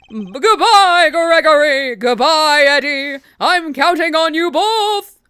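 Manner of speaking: loud, high farewell called after someone; calling out. Acted. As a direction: call out goodbye in a big character voice. Game character calls out a farewell.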